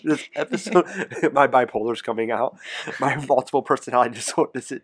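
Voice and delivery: giggly sudden mood swing; laughing. Real, not acted. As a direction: laugh it off, bubbly. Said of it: "you're scaring me".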